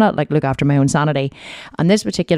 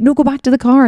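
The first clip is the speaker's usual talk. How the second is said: sharp high yell, much higher and louder; snapping at a child; 10 semitones higher, 5 dB louder than usual. Retold or imitated. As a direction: order the kid straight back to the car. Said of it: "I was like".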